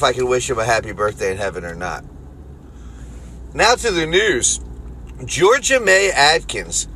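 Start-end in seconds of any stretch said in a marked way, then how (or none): 3.5-6.9 news anchor read. Acted. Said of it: "mock news anchor intro".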